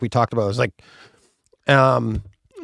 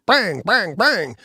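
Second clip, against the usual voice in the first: lighter, higher vocal bangs; imitating a handgun firing; 6 semitones higher, much slower than usual. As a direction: pop off three pistol shots. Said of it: "takes a handgun and it's like".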